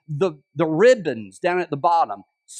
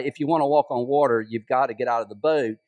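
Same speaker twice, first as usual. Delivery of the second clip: ordinary talk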